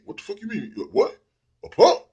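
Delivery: high, rising to a loud "A punk?!"; outraged retort. Retold or imitated. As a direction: play the offended friend firing back. Friend's outraged comeback.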